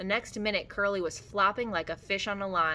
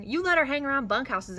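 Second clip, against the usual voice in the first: scolding; 7 semitones higher, 5 dB louder than usual. A narrator's reading. Carlson joins the quarrel.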